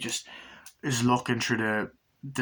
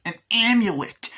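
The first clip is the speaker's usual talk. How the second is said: high, loud imitation; punched-out character voice; emphatic doll reply; 10 semitones higher, 7 dB louder than usual. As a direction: announce it impatiently. Imitates exasperated doll explaining.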